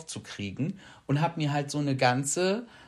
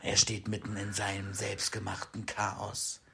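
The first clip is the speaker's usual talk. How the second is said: movie trailer voice; 5 semitones lower, 8 dB quieter than usual. Pretend. Parodies a cinema trailer announcement.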